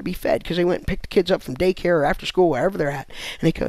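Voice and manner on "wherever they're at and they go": ordinary talk, nothing marked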